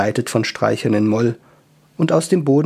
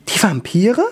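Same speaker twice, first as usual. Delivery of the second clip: put-on high, surprised elf girl; 8 semitones higher than usual. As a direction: ask it in a high startled voice.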